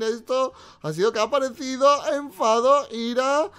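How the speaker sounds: high, stretched-out announcer build; big drawn-out episode reveal; dramatic title announcement. Pretend. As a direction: draw out the title slowly.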